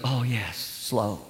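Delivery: low, slow, solemn pastor voice; a stately, churchly hush; mock dignified instruction. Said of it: "slow. ... dignified".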